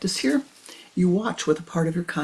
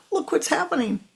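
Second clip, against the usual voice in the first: high, rising, pleading voice to the guru; retelling her upset to her teacher, higher and louder; distraught disciple begging for help; emotional plea; 7 semitones higher, 4 dB louder than usual. Retold or imitated. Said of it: "as I'm highly emotional".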